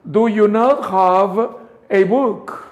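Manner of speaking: slow, clear, "not" stressed; slow clean demo. Shown as a demonstration. Teacher giving a slow, clean model for students. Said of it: "Y sale limpia la voz".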